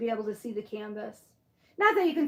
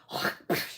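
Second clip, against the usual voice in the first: hawking up and spitting noise; throaty hawk, then a spit; imitated spitting; 4 dB quieter than usual.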